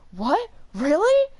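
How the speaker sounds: rising, high-pitched astonishment; surprised kids. Retold or imitated. All the kids reacting to the news, astonished. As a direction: react like an amazed kid.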